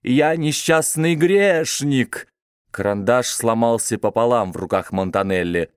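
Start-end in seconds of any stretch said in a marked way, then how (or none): 0.0-2.3 протяжно, выше и медленно, как старик; затянуть жалобно по-стариковски; старческий голос. Acted. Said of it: "затянул старческим голосом". (старческим протяжным голосом)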